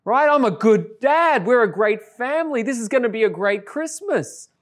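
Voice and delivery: high, bright self-congratulating thought; a giddy inner voice, pitched high; excited inner thought. Retold or imitated. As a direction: gush to yourself, proud dad moment. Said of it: "I think to myself".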